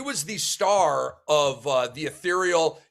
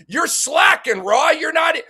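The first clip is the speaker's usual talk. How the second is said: sarcastic mock outrage, high and loud; 9 semitones higher, 5 dB louder than usual. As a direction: tease him with a fake scolding yell. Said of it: "I'm just being sarcastic".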